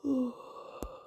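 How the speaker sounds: long, breathy tired sigh; sleepy sigh. A narrator's reading. Narrator sighing for the yawning, tired travellers, sleepy. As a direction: let out a drowsy sigh.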